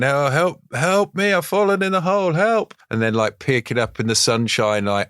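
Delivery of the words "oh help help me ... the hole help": high, plaintive calls for help; trapped old man. Retold or imitated. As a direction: call weakly up from the bottom of a hole. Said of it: "You can imagine him going".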